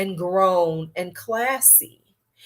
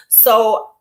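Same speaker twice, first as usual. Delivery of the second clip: ordinary talk, nothing marked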